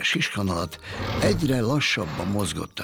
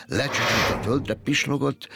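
usual speaking voice

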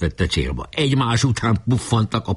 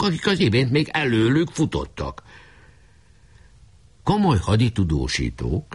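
ordinary delivery, nothing marked

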